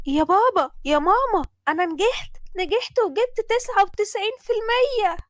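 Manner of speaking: high, loud joyful announcement; teen daughter shouting her results to her parents; excited shout. Acted. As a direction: burst in with the news. Sally announcing exam success.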